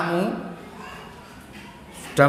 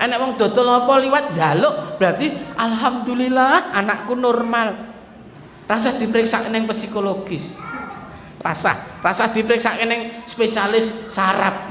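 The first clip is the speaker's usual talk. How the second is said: ordinary talk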